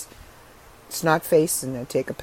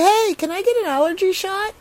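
pretend phone request; 11 semitones higher than usual. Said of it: "call my doctor and say".

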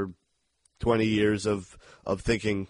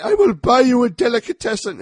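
loud, high begging villain voice; imitated villain plea, far above normal pitch; Blofeld; 13 semitones higher, 10 dB louder than usual. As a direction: cry out a desperate bargain. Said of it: "my favorite line".

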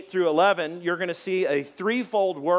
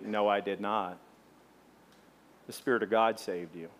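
lower, quieter firm words; clear, firm correction; 7 semitones lower, 5 dB quieter than usual. Retold or imitated. Pastor correcting a thankful churchgoer, quiet and firm. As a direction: correct them gently but firmly. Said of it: "then I say clearly".

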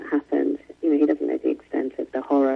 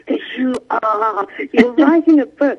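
put-on high, slow, knowing child voice; retold boy insisting, higher and slower; imitating her insistent son; 12 semitones higher, 5 dB louder, slower than usual. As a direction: drag it out like a kid who won't be fooled.

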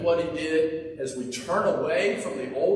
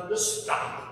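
mock rebuke. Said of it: "Don't you wish you could do that".

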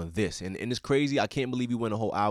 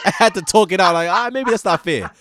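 excited; 11 semitones higher, 10 dB louder than usual. Real, not acted. Laughing self-correction about unfair comparison.

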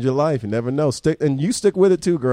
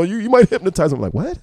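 ordinary delivery

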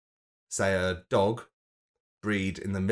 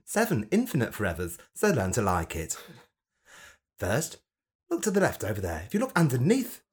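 funny camp voice; 2 semitones lower than usual. Acted. Host preaching to dead pets at Rainbow Bridge, camp voice. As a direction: welcome them like a flamboyant vicar. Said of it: "I did it in a funny camp voice".

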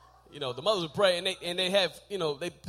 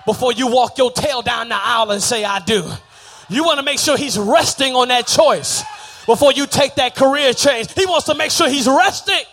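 loud sung preacher whoop over the organ; preaching at full force; 2 semitones higher, 14 dB louder than usual. Real, not acted. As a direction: ride the music and whoop it out.

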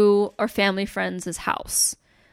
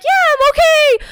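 very high, loud mock wail; retold freaking out; 8 dB louder than usual. Kid answering worried dad amid the chaos, high loud wail. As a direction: shout it back in a squealed panic. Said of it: "everyone was freaking out".

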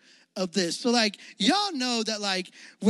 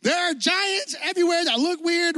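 mock-whiny complaint, slowed; whiny spies; 8 semitones higher than usual. Spies whining to the camp, mock complaint. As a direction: complain like a let-down tourist. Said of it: "They're like".

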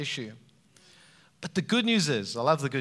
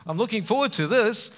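high, loud mock cheer; excited cheer; 6 semitones higher, 6 dB louder than usual. Adam cheering at God's promise of a helper, hammed-up delight. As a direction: whoop like a delighted kid. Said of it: "And man goes".

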